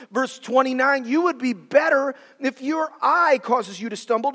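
ordinary delivery

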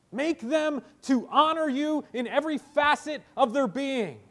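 loud, high impassioned plea to God; preacher's full-voiced intercession; praying fervently. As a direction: cry out in prayer, hammering each request. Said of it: "He's praying".

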